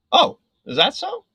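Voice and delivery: high, loud mock-surprised comeback; indignant challenge. Worker facing down the ranting customer, indignant challenge.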